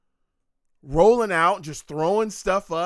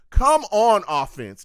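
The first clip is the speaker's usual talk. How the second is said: exasperated plea; 4 semitones higher, 7 dB louder than usual. Real, not acted. Fan urging the offense.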